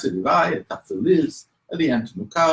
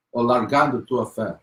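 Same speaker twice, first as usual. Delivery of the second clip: normal talking voice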